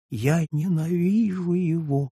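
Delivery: high, slow, anguished cry of hatred; beside herself with hatred for her husband. A narrator's reading. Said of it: "вскричала Жюли вне себя".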